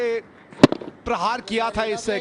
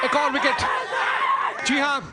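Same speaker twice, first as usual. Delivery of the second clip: excited laughing shout; 5 semitones higher than usual. Real, not acted. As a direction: cheer the wicket with a laugh. Another wicket falls, laughing shout.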